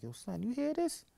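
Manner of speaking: very high, stunned question; mock disbelief to friend; incredulous aside to friend. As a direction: act amazed, asking your friend to confirm. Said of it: "I'm like".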